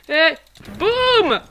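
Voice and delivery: crié fort et haut; célèbre le coup gagnant; boum triomphant. Real, not acted. Coup final au combat. (boum de victoire crié)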